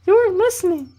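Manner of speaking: loud, very high falsetto camp leader; squeaky mock female scolding; bad girl voice. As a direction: check on them in a badly faked woman's voice. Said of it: "That was a really bad girl voice".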